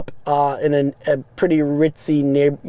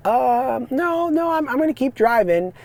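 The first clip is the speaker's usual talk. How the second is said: high-pitched, drawn-out no; retold awkward, higher-voiced turning down; awkward polite refusal; 10 semitones higher than usual. Driver to women inviting him in, awkward high refusal.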